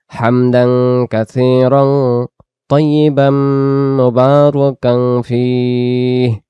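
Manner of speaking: হামদান কাসীরান তাইয়িবান মুবারাকান ফীহ word by word, very slow; slow recited Arabic. Shown as a demonstration. Teacher teaching the extra prayer, slow recitation. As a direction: recite each word separately and clearly. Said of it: "শুদ্ধ করে উচ্চারণ করেন".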